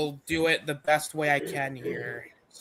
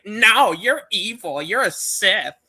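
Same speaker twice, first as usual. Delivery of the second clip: higher, indignant comic retort; mock accusing; 6 semitones higher, 4 dB louder than usual. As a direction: hurl a hammed-up accusation. Acted quarrel, accusing Maul.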